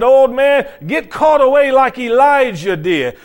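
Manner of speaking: loud, high-pitched jeer; mocking taunt. Children making fun of bald Elisha, loud mocking taunt. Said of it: "They started mocking him".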